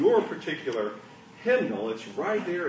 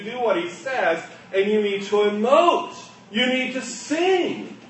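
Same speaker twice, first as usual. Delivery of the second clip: preaching; 6 semitones higher, 7 dB louder than usual. Acted. Preaching at full force.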